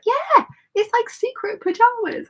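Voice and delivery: high, bright put-on voice; playful excitement. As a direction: say it like a delighted secret. Retold own proud reply.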